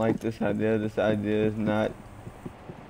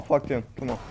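normal talking voice

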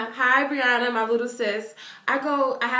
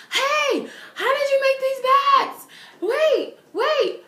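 put-on much higher, louder kid voice; imitating a hyper boy; 6 dB louder, slower than usual. Energetic boy quizzing the fan about her bags, hyper. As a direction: rattle off his eager questions.

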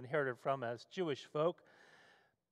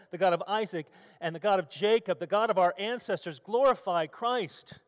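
put-on a touch of Brooklyn accent, higher, from [name]; lightly kept Brooklyn accent, pressing the words God; 3 semitones higher, 5 dB louder than usual. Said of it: "In his best Brooklyn accent".

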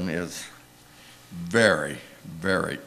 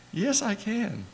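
playful mock high; 13 semitones higher, slower than usual. Speaker playfully insists on paying.

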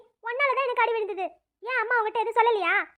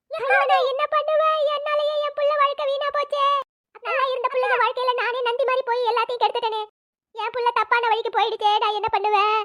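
raised, anguished self-blaming wail; wailing lament; 3 semitones higher than usual. Acted. Mother blames herself for her child's ruined life, anguished wail.

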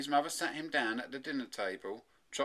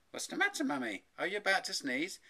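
lightly voiced boy, starting high and settling; puzzled child; 7 semitones higher than usual. A narrator's reading. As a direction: ask Mummy in a higher, puzzled voice. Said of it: "Tommy said".

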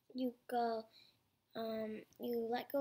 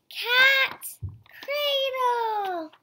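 very high, loud announcement of the name; big excited shout of the next trick; loud title call; 10 semitones higher, 12 dB louder, much slower than usual. Real, not acted. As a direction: belt it out like a show title. Announcing the next string figure.

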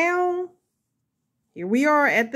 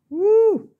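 excited woo; 11 semitones higher, 8 dB louder than usual. Real, not acted. Cheering after finishing a step.

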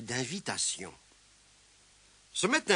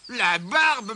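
loud, higher-pitched grumble; grumbling; 6 semitones higher, 7 dB louder than usual. A narrator's reading. Mole has fallen flat at the door, grumbling. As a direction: curse crossly after a fall. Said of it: "marmonna-t-il".

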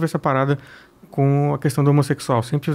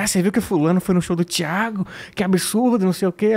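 higher-pitched mock gossip; irmãs da igreja; 5 semitones higher than usual. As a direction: imitate scandalized churchwomen gossiping. Imita irmãs da igreja fofocando. (imitando irmãs da igreja escandalizadas)

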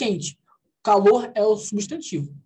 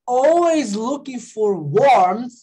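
slow reading; 3 semitones higher, 4 dB louder, much slower than usual. Shown as a demonstration. Teacher reads English slowly.

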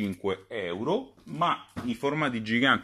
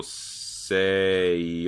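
usual speaking voice

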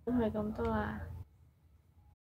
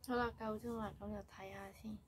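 talking with face mask on; wearing a sheet mask. Real, not acted. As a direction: lips framed by wet sheet. Seen 0: Wet sheet mask on face from 4.0s, peeled off by 11s.

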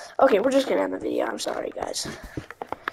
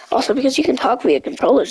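usual speaking voice

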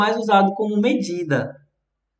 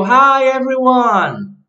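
bright, slow, higher read of a greeting; reading a chat greeting; 6 semitones higher, 6 dB louder, much slower than usual. Retold or imitated. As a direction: read it out like an excited viewer.

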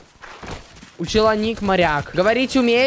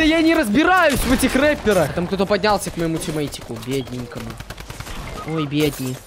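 ordinary talk, nothing marked

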